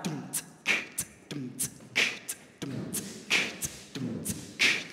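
soft, mostly voiceless drum sounds in time; beatbox a quiet steady kick, tick and cymbal pattern; beatbox rhythm. Shown as a demonstration. Leader demonstrates the new rhythm to audience, soft beatboxing. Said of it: "So now we have".

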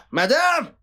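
high, loud call of Madame. Acted. Servant shouts for his madam across the house, high and loud. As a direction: yell across the house for her.

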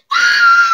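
sudden high, loud shriek; mock scream. Acted scream in a silly story.

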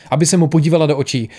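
ordinary delivery, nothing marked

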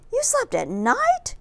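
high, slow astonished gasp. A narrator's reading. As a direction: gasp it out in shock. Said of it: "gasped another".

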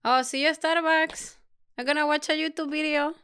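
put-on Spanish-accented English. Asked by her partner to show how Spanish speakers talk, she does a playful mock Spanish accent. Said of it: "how would they say it".

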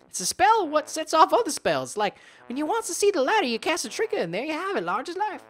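put-on high, rustic old hermit. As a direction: play the chatty hermit with a country twang. Hermit voice continues.

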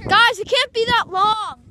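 loud appeal to the group; calling out. Real, not acted. Organizer warns players about video length, calling out loudly. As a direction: raise your voice across the field.

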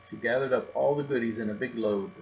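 plain reading voice

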